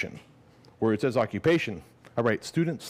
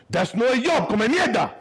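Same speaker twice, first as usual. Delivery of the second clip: high, loud furious father; angry dad; 11 semitones higher, 4 dB louder than usual. Retold or imitated. Dad scolding son about the taco job, yelling high and loud. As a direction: throw up your arms and yell it.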